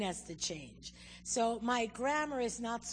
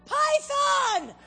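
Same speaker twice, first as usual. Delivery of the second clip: high, loud cry of alarm; hammed-up emergency shout, much higher and louder; yell for help; 9 dB louder, much slower than usual. Shown as a demonstration. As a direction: holler it out as if calling for rescue. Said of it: "You can yell".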